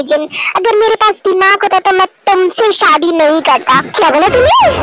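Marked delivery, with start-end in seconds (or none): 3.8-4.7 indignant. Acted. Wife snaps at husband.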